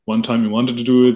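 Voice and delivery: ordinary talk, nothing marked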